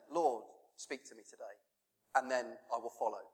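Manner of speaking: hushed prayer. Pretend. Preacher voicing a daily prayer for God's voice, softly. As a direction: ask it gently, barely above a murmur.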